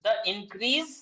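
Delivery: slow, deliberate dictation, each phrase spaced out. Shown as a demonstration. Teacher dictating exam answer to students, slow dictation. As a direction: read out notes word by word for students to copy.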